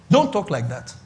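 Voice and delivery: normal talking voice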